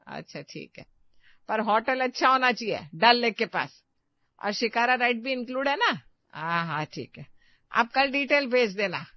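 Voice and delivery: loud, showy phone talk meant to be overheard; loud fake phone call. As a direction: perform a call for the kitchen to hear. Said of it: "जानबूझकर hall में जोर से बोली". (जानबूझकर ज़ोर से, दिखावटी phone बातचीत)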